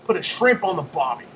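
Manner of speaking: Australian catchphrase, hammed up; Aussie accent. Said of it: "Aussie accent".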